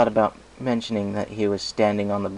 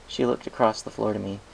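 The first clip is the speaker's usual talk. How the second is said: plain reading voice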